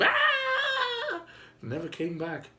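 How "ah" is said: high mock scream of fleeing kids; hammed-up children's scream. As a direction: squeal high like running children.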